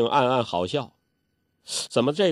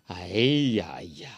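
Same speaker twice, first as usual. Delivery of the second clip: plain reading voice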